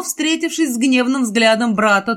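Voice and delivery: usual speaking voice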